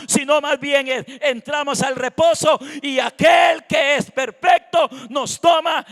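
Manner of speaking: loud, driving sermon delivery; full-force preaching. Real, not acted. Preacher telling the congregation about surrender to Christ, full-force preaching. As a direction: preach it out with punched key words.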